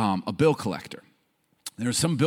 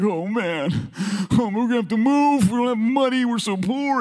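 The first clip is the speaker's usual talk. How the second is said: mock panic; 11 semitones higher than usual. Boy worrying while his mom cries, high mock panic. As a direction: wail it like a worried kid, hammed up.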